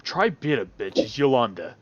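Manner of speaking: normal talking voice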